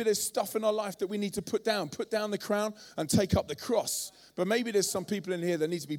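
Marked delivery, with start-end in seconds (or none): none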